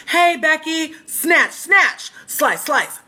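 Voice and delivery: loud, high mock confrontation; mock attack on Becky. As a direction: ham up charging at a Becky. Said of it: "I run up on a Becky like".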